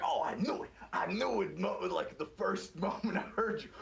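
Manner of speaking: hammed-up know-it-all; a smug gamer gloating, voice pushed up high; mock American gamer. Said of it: "he's like".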